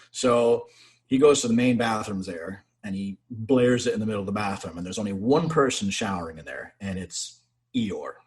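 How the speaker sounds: plain reading voice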